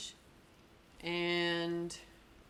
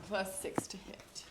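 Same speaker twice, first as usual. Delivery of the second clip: whisper; 5 dB quieter, slower than usual. Real, not acted. Whispered dice total.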